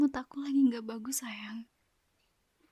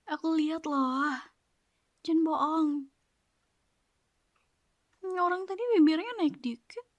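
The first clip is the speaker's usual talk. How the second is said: louder, higher playful teasing, out of the whisper; 4 semitones higher, 7 dB louder than usual. Acted. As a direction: catch her smiling and tease her. Teasing partner who smiled. (menggoda dengan ceria, lebih keras)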